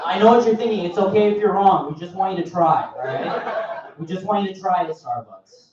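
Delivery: hammed-up upbeat trainer; mock corporate trainer. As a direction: cheer on the trainees like a chirpy boss. Trainer voice continues.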